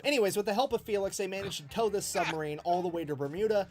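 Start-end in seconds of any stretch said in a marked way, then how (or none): none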